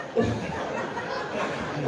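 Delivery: soft breathy laugh at the audience card; laugh, delighted by the joke; amused laugh. Real, not acted. Speaker to audience, laughing softly at a card.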